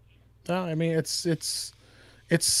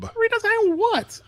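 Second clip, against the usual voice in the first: incredulous scoff; 13 semitones higher, slower than usual. Real, not acted. Mocking the redesign idea.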